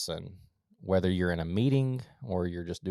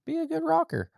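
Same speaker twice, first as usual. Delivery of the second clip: much higher sing-song. Acted. Perry's tip repeated to listeners, high lilting. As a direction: lilt it high like lulling a baby.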